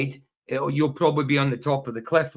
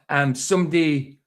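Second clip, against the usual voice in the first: ordinary talk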